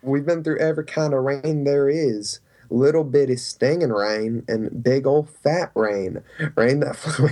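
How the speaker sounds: slow, thick southern twang; Forrest Gump southern twang. Acted. An impression of a film character in a thick southern accent. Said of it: "putting in that southern twang".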